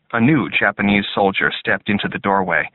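plain reading voice

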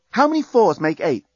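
high-pitched brisk quiz question; quizzing. A narrator's reading. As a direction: put it like a teacher testing a pupil. Quizzing the bomb to reverse countdown.

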